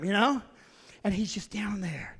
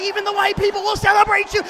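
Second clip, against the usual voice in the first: exuberant shout; 8 semitones higher, 10 dB louder, faster than usual. Retold or imitated. Crowd cheering.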